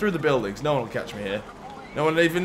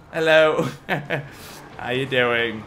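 normal talking voice

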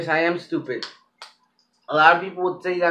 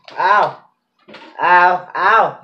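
pained ow; 2 semitones higher, 7 dB louder, much slower than usual. Real, not acted. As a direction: cry out from burning heat. Mouth on fire from spice.

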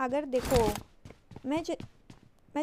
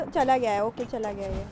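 ordinary talk, nothing marked

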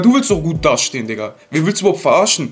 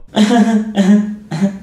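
short bursts of laughter; laughing; 4 semitones higher than usual. Real, not acted. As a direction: laugh in a few separate bursts. Reacts to clip about eyebrows.

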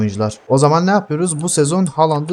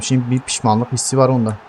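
ordinary delivery

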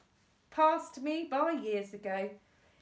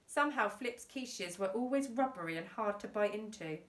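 ordinary delivery

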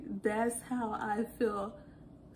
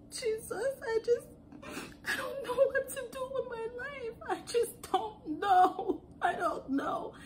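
high, hammed-up weepy whine; fake-sob it, stretching the words; mock crying; 12 semitones higher than usual. Role-playing being overwhelmed by life.

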